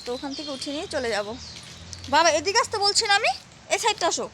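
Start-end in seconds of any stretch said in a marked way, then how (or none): 2.1-4.2 calling out. Real, not acted. Calling daughter to roadside.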